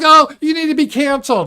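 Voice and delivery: high, loud whiny demand; mock outrage. Streamer mocking cancellers, high and loud. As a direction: screech it like a cartoon scold.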